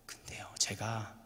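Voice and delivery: slow hushed whisper. Real, not acted. Preacher whispering to the congregation mid-story, slowly. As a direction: drop to a slow secret whisper.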